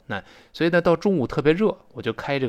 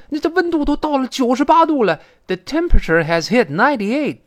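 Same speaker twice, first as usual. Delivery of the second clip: very high, louder exclamation; theatrical disbelief, pitched way up; acted shock; 10 semitones higher, 6 dB louder than usual. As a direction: announce the heat in astonishment. Acts out dialogue, shocked at temperature.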